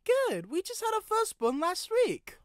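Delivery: proud Chuck. A narrator's reading. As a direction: lift the pitch, proud of the baby. Chuck voiced in dialogue.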